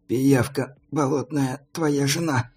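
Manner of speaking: slow, barely audible mutter. A narrator's reading. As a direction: say it under your breath. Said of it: "чуть слышно сказал Мармат".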